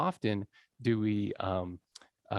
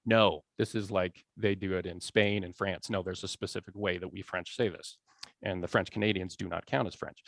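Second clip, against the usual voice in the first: lightly voiced rule-keeper, lower; a hint of a lofty language authority, pitched down; prescriptive grammarian; 6 semitones lower, 4 dB quieter than usual. Teacher voicing French language authorities, prescriptive. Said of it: "prescriptive grammar is like".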